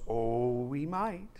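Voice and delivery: lightly voiced ominous metal man; soft, hesitant warning, rising at the end; Tin Man. Tin Man warns Dorothy about wild animals, lightly ominous. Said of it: "the Tin Man jumps right in and he goes".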